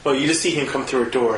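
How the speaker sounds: ordinary talk, nothing marked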